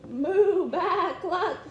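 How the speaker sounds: loud playful barnyard chorus; animal racket. Acted. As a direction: blast the animal noises to scare him. Animals burst out at the farmer.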